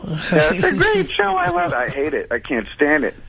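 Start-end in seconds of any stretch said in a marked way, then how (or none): 0.1-1.8 mock gushing wife. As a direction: ham up her gushing. Caller mocks wife's gushing.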